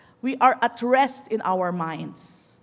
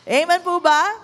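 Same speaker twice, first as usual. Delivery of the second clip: ordinary delivery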